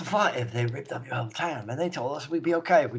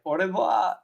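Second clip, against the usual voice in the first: fake French accent; 6 semitones higher than usual. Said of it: "making fake French noises".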